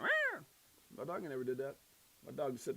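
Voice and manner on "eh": high, sharp mock "Eh"; imitating the sudden swipe; cat hiss. Host repeating the cat noise in a high "Eh". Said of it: "My dog in there went".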